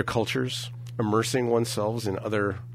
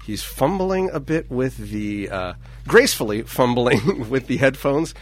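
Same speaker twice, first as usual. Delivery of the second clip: play-by-play commentary; 3 semitones higher, 4 dB louder than usual. Acted. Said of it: "This is very exciting play-by-play".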